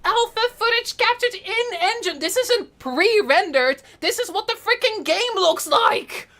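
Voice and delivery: high, thrilled reading aloud; excited reading. Real, not acted. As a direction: read it out bursting with delight. Reading trailer text excitedly.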